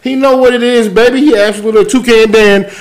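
high, hyped shout-out; excited. Real, not acted.

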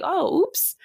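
mock surprise. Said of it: "I'm just like".

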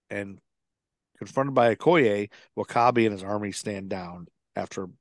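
ordinary delivery

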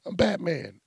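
high-pitched, worked-up hero retort; Batman. Retold or imitated. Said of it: "And he's like".

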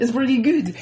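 high mock-French voice; hammed-up cartoon French accent; bad French accent. Shown as a demonstration. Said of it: "this is my French".

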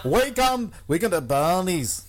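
loud, high mock Scottish; Scottish accent. Joking about a Scottish Weekend at Bernie's, hammed-up Scottish accent. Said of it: "That was my Scottish accent".

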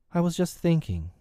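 high-pitched, mildly nervous; a touch of fear; a little scared. A narrator's reading. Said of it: "a little scared".

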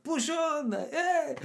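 high-pitched hammed-up Italian accent; mock Italian accent. Parents gesticulating wildly at football, mock Italian accent. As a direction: put on a squeaky mock Italian voice. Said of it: "do an Italian accent there".

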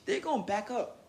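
ordinary talk

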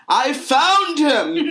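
high, slow, laughing cry of discovery; overexcited shout. Acted. Harry announcing his discovery to friends, hammed-up triumphant yell. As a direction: stretch it out like you've cracked the case. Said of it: "I don't think that's how he announced it".

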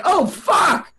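high, exasperated comic tirade; worked-up rant. Acted. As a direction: rant till you go red in the face. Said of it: "you've gone really red".

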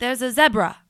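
zebra with a short "e", ZEB-ra; playful example, zebra as ZEB-ruh; British-style pronunciation. Shown as a demonstration. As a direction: say zebra to rhyme with Debra. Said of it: "That's how they pronounce it".

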